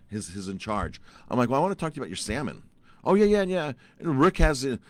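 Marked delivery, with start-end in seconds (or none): 1.5-2.6 higher-pitched, rapid retold request; quick, high opening ask; eager request. First request to the chef. 3.0-4.8 high, loud mock agreement; over-eager mimicked yeah-yeah; breezy brush-off. Chef brushes off his request.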